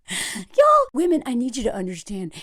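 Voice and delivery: usual speaking voice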